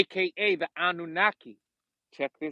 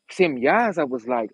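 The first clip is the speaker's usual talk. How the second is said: ordinary delivery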